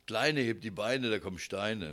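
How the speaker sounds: the rhyme of a couple long together; plainer, older-love rhyme. Shown as a demonstration. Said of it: "dann heißt es".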